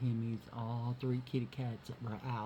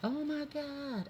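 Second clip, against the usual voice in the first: very high, slow, drawn-out amazement; amazed gasp; 14 semitones higher, slower than usual. Retold or imitated. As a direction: stretch it out up high in disbelief. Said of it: "I said".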